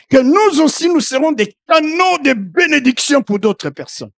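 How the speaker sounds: loud, impassioned sermon delivery; fervent preacher calling out; preaching at full force. Acted. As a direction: preach it out to the whole church. Preacher resumes after aside.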